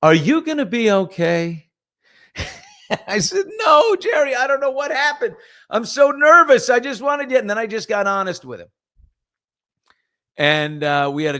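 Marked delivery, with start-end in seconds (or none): 0.0-1.7 higher, louder mock concern; lightly voiced concerned Jerry; Jerry asking. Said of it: "and he goes". 3.6-7.4 much higher and louder, nearly shouting; high, loud mock desperation; frantic confession. Confessing nerves to Jerry.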